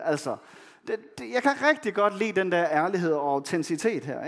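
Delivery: usual speaking voice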